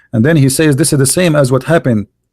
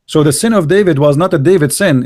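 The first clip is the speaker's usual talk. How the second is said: ordinary delivery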